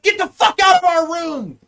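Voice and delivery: loud, high-pitched yell at an intruder; imitated furious outburst; angry shout. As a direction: order him out at full volume. Acting out startled roommate yelling at intruder.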